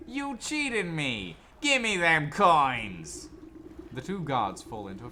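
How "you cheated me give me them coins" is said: slow, furious yelling guard; angry shout. Acted. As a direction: bellow the accusation slowly. Guards come to blows.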